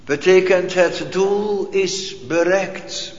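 usual speaking voice

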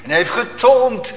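loud preaching, pressing the congregation; preacher warning the congregation about sin, loud and urgent; fiery preaching. Acted. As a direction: thunder it from the pulpit. Preaching at full force.

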